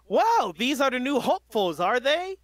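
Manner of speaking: high, loud radio announcer hero; All Might voice. Acted. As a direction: boom it like a radio host. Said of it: "radio mic voice for All Might".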